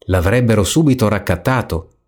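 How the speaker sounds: ordinary delivery, nothing marked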